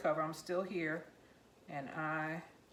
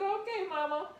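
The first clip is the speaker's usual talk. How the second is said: laughing reply; 12 semitones higher than usual. Retold or imitated. Teasing mom, laughing.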